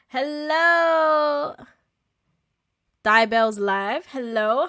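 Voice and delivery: bright, loud high hello; loud greeting. Real, not acted. Greeting a new viewer on stream, loud and high. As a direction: call out a big welcome.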